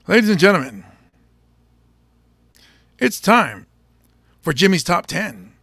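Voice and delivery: mock TV presenter, moderately done; TV host announcement. Shown as a demonstration. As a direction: introduce the segment like a show host. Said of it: "Me trying to be a T V dude".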